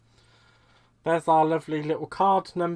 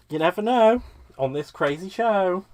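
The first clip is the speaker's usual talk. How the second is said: usual speaking voice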